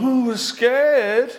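high, loud, drawn-out fake fear; mock scared. Preacher acting the army copying Saul's fear, mock scared. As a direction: wail it slowly as a whole frightened army. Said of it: "The army has gone".

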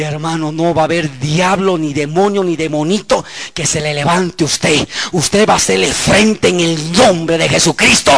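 usual speaking voice

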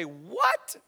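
incredulous "what?", swooping up at the end; mock-stunned double take; acted disbelief. Hosea reacting to God's shocking order.

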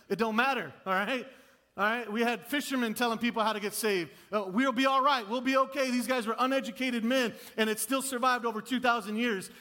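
loud, high-pitched pulpit encouragement; forceful preaching. Real, not acted. Preacher reassures nervous believers they can share faith, forceful preaching. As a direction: drive the reassurance home loudly.

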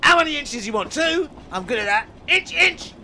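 high, squeaky little man; squeaky character voice. Acted. Inch man counting inches.

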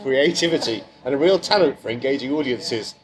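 reading a glowing bio with growing glee, laughing; hammed-up intro read. Host reading the guest's bio to her, hammed-up and laughing.

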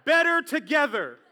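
loud and high, a rallying cry; loud declaration. Shown as a demonstration. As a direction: proclaim it to your neighbor. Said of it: "tell him".

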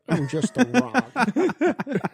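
slow, high, drawn-out mock sorrow; mock sad. Joking that The Rock plays himself, mock sad. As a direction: drag it out like a hammed-up sad star. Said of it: "Does he do that sad one".

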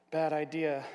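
ordinary delivery, nothing marked

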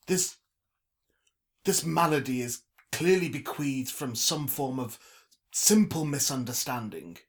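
loud, halting, grandiose declaration; formal and emphatic, with weighty pauses; pompous Trevor, clearing things up. A narrator's reading. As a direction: pronounce it like a stately orator. Trevor addresses the stall holder.

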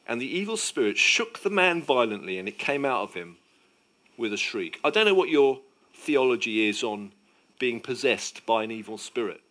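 ordinary talk, nothing marked

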